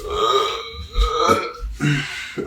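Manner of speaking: a long, breathy real yawn. Real, not acted. Vlogger yawning mid-review, an audible real yawn. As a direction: let a tired yawn slip out mid-sentence. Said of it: "excuse me again for the yawn".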